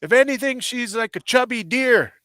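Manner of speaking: high, slow confronting voice; retold angry telling-off. Narrator confronting the husband, high slow angry. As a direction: square up to the man, slow and high. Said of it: "And I said".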